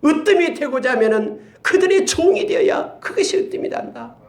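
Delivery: usual speaking voice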